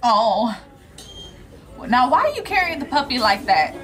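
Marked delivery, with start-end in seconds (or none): none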